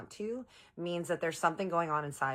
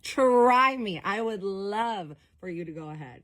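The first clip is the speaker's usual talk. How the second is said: higher, much louder provoking dare; defiant dare; 5 semitones higher, 6 dB louder, slower than usual. Retold or imitated. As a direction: push him to blow up, daring and loud. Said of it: "looking at him like".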